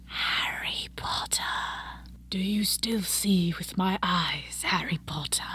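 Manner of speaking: slow, menacing whisper, very soft; a slow sinister whisper from the dark; Voldemort's whisper. Acted. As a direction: creep it out slowly like the Dark Lord. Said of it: "in an unmistakable voice, the voice of Voldemort".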